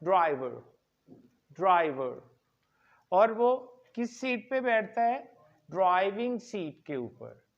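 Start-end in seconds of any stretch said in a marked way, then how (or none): 0.0-2.4 ड्राइवर with the last r dropped, drai-vuh; teacher modelling ड्राइवर; silent final r. Shown as a demonstration. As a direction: say ड्राइवर lower, no r at the end. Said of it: "इसमें last आर जो है, वो silent करना है".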